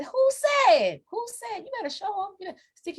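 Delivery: high excited cheering; high hype yell. Real, not acted. Urging the kid on.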